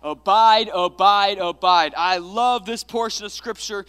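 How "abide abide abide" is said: loud shouted declaration. Real, not acted. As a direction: shout it like you want it. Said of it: "shout it like you want it".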